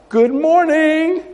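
high, drawn-out singsong greeting; calling out. Retold or imitated. Jogger hailing two office workers, calling out. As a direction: call it out high and stretched, as if across a street. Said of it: "I would just call out".

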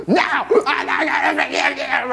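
put-on high child's voice, pouting; a small girl grudging, high-pitched; little girl voice. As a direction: play the sulky daughter. Daughter Riley voiced.

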